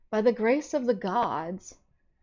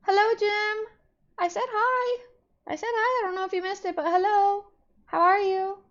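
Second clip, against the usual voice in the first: much higher, louder sing-song hellos; chirpy greeting voice; 12 semitones higher, 4 dB louder than usual. Real, not acted. Streamer greeting viewers Ted and Bean, high chirpy hello voice. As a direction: greet them like excited friends, way up high.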